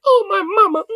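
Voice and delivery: put-on simper it like a clingy little boy; mock the vice president as a mama's boy. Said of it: "here you are, be like".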